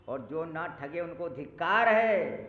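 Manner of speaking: loud, slowed proclamation; preaching at full force. Acted. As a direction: thunder it to the crowd. Preacher's emphatic declaration. (ज़ोर से, धीमा उपदेश)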